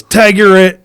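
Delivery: agent's gleeful payback; bigger taunt. Retold or imitated. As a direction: shout the payback.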